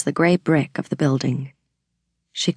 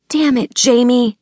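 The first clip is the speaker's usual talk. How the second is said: higher, louder frustrated snap; angry outburst; 8 semitones higher, 4 dB louder than usual. Acted. Sister scolding her guilty brother, angry outburst. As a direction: vent your frustration at him.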